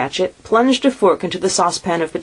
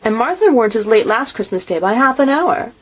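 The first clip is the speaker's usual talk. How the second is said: much higher, fretting mother's complaint; fussing mother; 8 semitones higher than usual. A narrator's reading. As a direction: scold lightly about the late ones. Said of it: "said Missus Cratchit".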